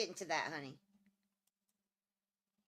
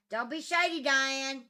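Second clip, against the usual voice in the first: teasing call; 4 semitones higher, 9 dB louder, slower than usual. Real, not acted. Teasing pet loudly.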